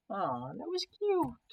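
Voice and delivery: very high, drawn-out cooing; mock-adoring, stretched and high; teasing coo. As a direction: coo it slowly in a high put-on voice. Onlooker teases the pair.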